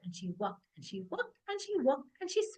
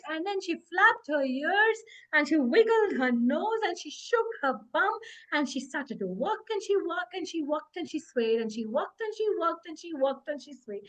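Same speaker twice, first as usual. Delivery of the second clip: plain reading voice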